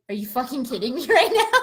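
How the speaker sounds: outraged but laughing; retold outrage. Quoting herself angry at him.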